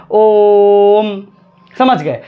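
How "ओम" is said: ओम with the ओ held long and louder, falling onto म; slow chanted ओम; prolonged Om. Shown as a demonstration. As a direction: chant ओम, stretch the ओ three beats. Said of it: "म पर गिरा देना है".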